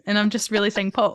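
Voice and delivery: ordinary talk, nothing marked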